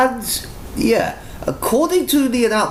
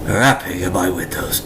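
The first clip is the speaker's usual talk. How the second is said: soft, hushed noir whisper; eerie whispered inner monologue; whispered narration; 11 semitones lower, 5 dB quieter than usual. A narrator's reading. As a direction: breathe the narration. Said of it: "we get more narration".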